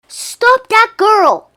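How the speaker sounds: very high, loud, slow witch's shout. A narrator's reading. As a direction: screech the order after her. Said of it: "shouted the checkout witch".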